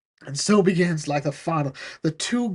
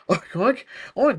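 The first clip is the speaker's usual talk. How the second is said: mock oinks; 5 semitones higher than usual. Mocking a pig.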